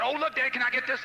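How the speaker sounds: high, loud imitation of an eager kid; excited kid voice; pleading child. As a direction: beg like a child in a store. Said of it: "they wanna get this".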